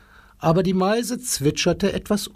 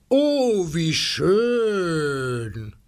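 delighted exclamation; 2 semitones higher, much slower than usual. Acted. Herr Nimmermüd gleefully hoping his coal bill is stuck, lightly voiced. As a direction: sing out a pleased oh. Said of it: "rief Herr Nimmermüd".

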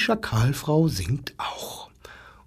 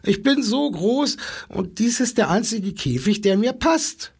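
much higher, whiny plea; whining giraffe; 8 semitones higher than usual. A narrator's reading. Giraffe whining at the wolf, high and plaintive. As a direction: wail it high and helpless. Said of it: "jammerte die Giraffe".

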